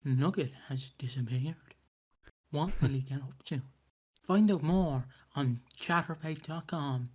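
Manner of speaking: slow, soft, low creepy narrator; a spooky documentary voice-over; haunting voice. Shown as a demonstration. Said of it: "I just do it like this".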